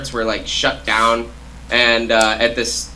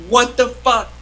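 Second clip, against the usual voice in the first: shocked disbelief; 10 semitones higher than usual. Retold or imitated. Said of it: "And I was like".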